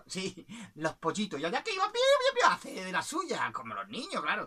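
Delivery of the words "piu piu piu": very high, louder chirpy peeps; chick peeps. Acted. Chicks hurrying off to mischief, high loud peeps.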